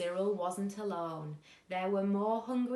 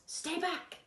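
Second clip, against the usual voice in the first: high warning shout; 9 semitones higher than usual. A narrator's reading. Bruce shouts at Cyril to stay back, high. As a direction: order him off. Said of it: "shouted Bruce".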